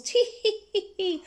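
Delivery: high, put-on tittering; stifled giggles. A narrator's reading. Kids hiding their giggles from the teacher, tittering. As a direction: titter as if holding it in. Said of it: "stiffen their giggles".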